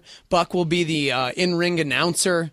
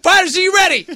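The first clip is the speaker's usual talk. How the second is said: high, loud fight-start shout; referee's call; 12 semitones higher, 8 dB louder than usual. Shown as a demonstration. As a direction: holler to both fighters, then yell the start.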